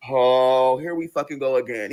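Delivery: low-pitched, weary “here we fucking go again”; low exasperation. Acted. Girlfriend facing another quarrel, low exasperation. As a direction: groan it out low.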